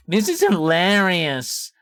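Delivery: high, slow exaggerated middle-aged American lady voice; exaggerated American woman. Shown as a demonstration. Streamer mocking Hassan's cadence in a hammed-up American accent. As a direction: stretch it out like an old American woman. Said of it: "if you say it in an exaggerated American accent".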